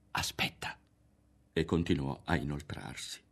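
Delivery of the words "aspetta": urgent hushed whisper. A narrator's reading. Berto cautioning Ario to wait, whispered. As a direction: whisper it, finger to the lips. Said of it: "e sussurrò".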